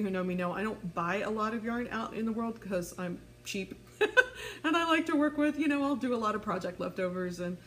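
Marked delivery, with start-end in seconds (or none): 4.0-4.6 high, quick self-mocking laugh. Real, not acted. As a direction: laugh at yourself. Laughing at being cheap.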